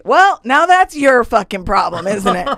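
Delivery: loud, high, slow taunt; defiant retort. Retold or imitated. Inmate throws food back at guard, loud defiance. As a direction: throw it back at full volume, drawn out.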